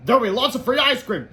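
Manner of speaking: high, loud teacher voice; teacher's announcement. A narrator's reading. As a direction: announce it loudly to the class. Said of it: "said Miss Ribble".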